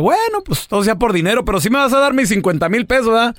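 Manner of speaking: high, reluctant haggling; el feo. Acted. Poor man settling a price with the devil, high voice. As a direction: give in with a high whine. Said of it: "Dice el feo".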